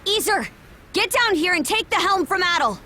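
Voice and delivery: shouted order. Acted. Captain barking orders in battle.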